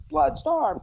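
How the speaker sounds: high-pitched mock cry of grief; anguished cry. Young Cyclops over dead Bloodstorm, acted anguished cry. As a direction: call the name out high, hammed-up.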